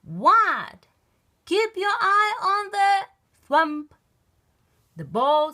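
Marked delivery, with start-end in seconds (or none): 0.0-0.9 high, loud What; loud reply. Acted. Froggy answering the coach, loud. 1.4-3.9 high loud call; yelled warning. Acted. Coach warning Froggy, high loud call. Coach yells; ball hits.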